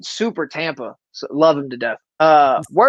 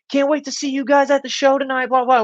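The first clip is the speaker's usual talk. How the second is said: put-on 1 chirpy fan girl; 8 semitones higher than usual. Girl on cruise greets comics.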